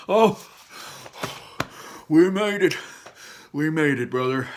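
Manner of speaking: high, loud then easing relief; relieved joy. Acted. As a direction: burst with relief, then settle. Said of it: "said Barley".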